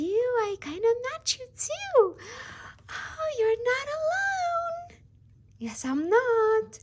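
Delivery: very high, slow, sweet doll voice. Pretend. Doll reassures her friend, very high and sweet. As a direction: play a cheerful little doll. Dolls chatting to each other.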